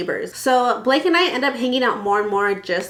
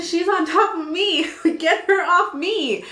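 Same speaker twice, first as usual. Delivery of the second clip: high-pitched, slow protest; acted-out exasperated yell for help; retold yelling; 8 semitones higher, 3 dB louder, slower than usual. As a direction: stretch it out high, mock-yelling. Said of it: "I'm literally yelling".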